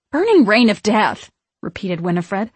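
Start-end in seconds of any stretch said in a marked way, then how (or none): 0.1-1.3 loud, falling emphatic repetition; emphatic repeat. A narrator's reading. Winifred repeating the threat to her sisters, loudly. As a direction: repeat it forcefully.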